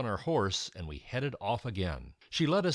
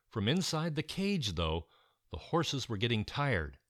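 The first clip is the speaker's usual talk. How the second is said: usual speaking voice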